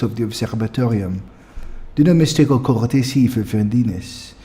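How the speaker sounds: put-on haughty French-accented elf; lofty refusal in a French accent. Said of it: "give them all a French accents".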